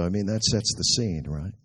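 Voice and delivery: ordinary delivery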